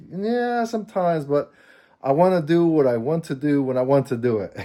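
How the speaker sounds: imitating his wife's Japanese accent, starting high; light retold Japanese accent, high on the yeah; husband fondly quoting his wife in a Japanese accent. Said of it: "the way she said it with her Japanese accent".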